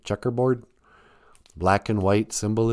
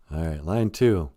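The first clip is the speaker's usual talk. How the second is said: usual speaking voice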